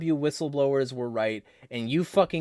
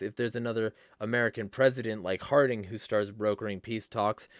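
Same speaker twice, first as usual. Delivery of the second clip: ordinary talk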